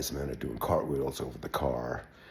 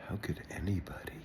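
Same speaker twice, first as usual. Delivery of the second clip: soft, disbelieving whisper, higher; a shocked mother at the wrecked lawn, whispering; hushed heartbroken disbelief; dismayed whisper; 6 semitones higher, 5 dB quieter than usual. Retold or imitated. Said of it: "She's like".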